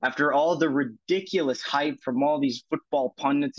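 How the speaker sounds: ordinary talk, nothing marked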